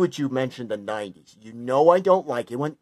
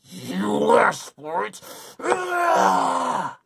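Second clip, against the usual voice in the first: very slow, then a high raging shout; 6 semitones higher, much slower than usual. Acted. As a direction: build the rage into a scream. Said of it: "Sonic is raging again".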